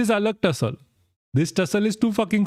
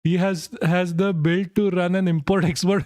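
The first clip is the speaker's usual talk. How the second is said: usual speaking voice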